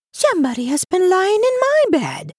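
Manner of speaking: very high, birdlike mama bear voice; chirping medium-sized bear. A narrator's reading. Medium-sized bear finding her bed used, chirping high. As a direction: chirp it like a little bird. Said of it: "sounded like a little chirping bird".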